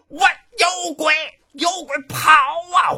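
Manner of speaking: 高声惊慌大喊; 吓破胆似的喊出来; 惊叫有鬼. Acted. 众人惊醒后喊有鬼. (惊恐大喊)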